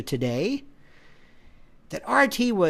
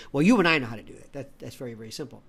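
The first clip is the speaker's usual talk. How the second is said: normal talking voice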